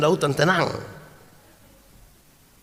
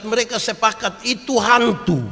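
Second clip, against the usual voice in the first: emphatic; 6 semitones higher, 4 dB louder than usual. Retold or imitated. Disciples decide it is a ghost.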